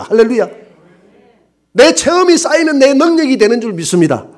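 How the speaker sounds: high, loud, driving preacher's cry; fervent preaching. Acted. Preacher urging the congregation to experience God, fervent preaching. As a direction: preach it out full force to the congregation. Preaching builds to full force.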